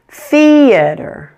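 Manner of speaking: "theater" with its first syllable long and high; stressed first syllable. Shown as a demonstration. Teacher repeating the word for learners, first syllable stressed. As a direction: hit the start of "theater" hard, let the rest fall. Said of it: "This should be much louder and longer".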